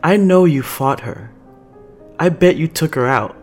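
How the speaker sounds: petulant, heated jab; resentful accusation. Acted. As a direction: accuse them with hurt pride. Angelica's resentful rant.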